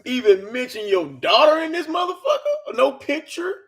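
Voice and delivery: slow, loud, indignant call-out at the author; outraged disbelief. Real, not acted.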